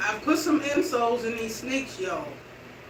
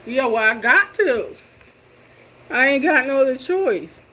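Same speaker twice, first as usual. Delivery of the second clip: louder, insisting she has no choice; louder, determined insistence; emphatic; 2 semitones higher, 8 dB louder than usual. Real, not acted. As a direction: push it out firmly, like there's no way around it.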